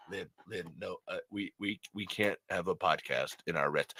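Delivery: low, soft cartoon husband voice; weary husband. Husband telling wife no podcast in restaurant, low impression. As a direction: object low and quietly.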